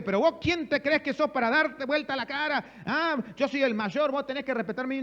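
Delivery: high-pitched pretend telling-off; mock indignant scolding. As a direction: play the affronted elder brother pulling rank. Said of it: "no para decirle". (reto indignado y fingido de hermano mayor)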